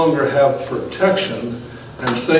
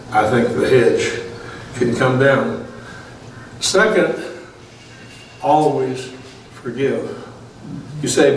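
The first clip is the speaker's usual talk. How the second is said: ordinary talk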